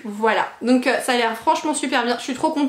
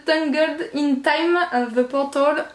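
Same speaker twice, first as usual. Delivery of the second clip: slow, hammed-up English accent on the titles; playful fake English accent; mock English accent; slower than usual. Shown as a demonstration. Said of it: "je fais mon accent à la noix de coco".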